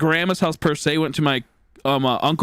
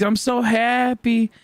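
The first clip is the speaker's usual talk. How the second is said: pretend happy; 7 semitones higher, slower than usual. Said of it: "trying to pretend pretty happy".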